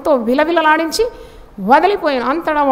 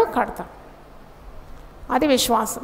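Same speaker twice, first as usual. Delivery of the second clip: ordinary delivery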